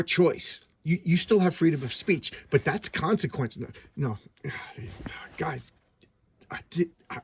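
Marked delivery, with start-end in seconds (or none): 5.4-7.2 whisper. Real, not acted. Unvoiced aside to viewers.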